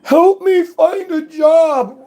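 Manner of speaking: mimicking the commenter asking for a job; mocking whiny voice. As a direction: whine it like a needy beggar.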